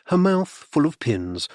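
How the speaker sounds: ordinary delivery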